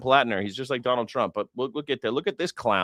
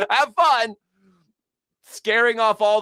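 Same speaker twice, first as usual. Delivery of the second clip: laughing taunt; 10 semitones higher, 4 dB louder than usual. Real, not acted. Mocking the girl's father.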